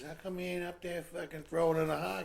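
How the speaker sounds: high, loud acted question; incredulous concierge. Concierge questioning a honeymooner off to go fishing, loudly incredulous. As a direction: press him with loud disbelief.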